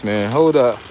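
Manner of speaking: usual speaking voice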